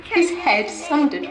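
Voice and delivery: tragic heroine sorrow. Acted. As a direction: cry out over the body. Edith finds Harold's body.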